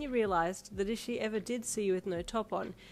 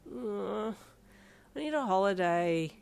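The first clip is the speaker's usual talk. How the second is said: drawn-out, weary moan; much slower than usual. A narrator's reading. Tired parent complaining to Darby, moaning. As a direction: drag it out as a complaint. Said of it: "you moaned to Darby".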